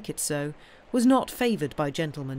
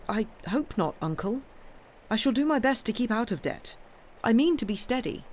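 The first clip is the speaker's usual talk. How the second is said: higher-pitched, lightly voiced young man; earnest young nephew; 7 semitones higher than usual. Nephew confessing debts to his uncle, earnest. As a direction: insist you are telling the truth.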